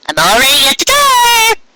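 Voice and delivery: high-pitched voice from the doorway; sudden high voice at the door; Ditsy Do's voice. A narrator's reading. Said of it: "Ditsy Do's voice said".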